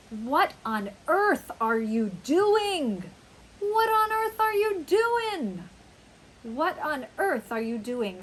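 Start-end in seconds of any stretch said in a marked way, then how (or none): 0.1-3.0 slow, loud, emphatic incredulity; shocked disbelief. Shown as a demonstration. Teacher acting out shocked disbelief at someone's behavior, incredulous. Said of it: "so surprised, we're confounded, we're incredulous". 3.6-5.7 high-pitched, loud incredulous cry; shocked outburst. Shown as a demonstration. Teacher demonstrating shock at what someone is doing, high and loud. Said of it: "we're shocked, we might say".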